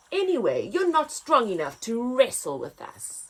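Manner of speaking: angry rhino. Acted. Rhino scorning the weak giraffe, gruff. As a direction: dismiss him like a grumpy rhino. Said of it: "bellowed an angry-looking rhino".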